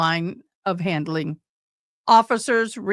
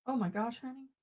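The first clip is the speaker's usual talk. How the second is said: quiet aside; 2 semitones higher, 14 dB quieter than usual. Real, not acted. Aside to someone off-mic.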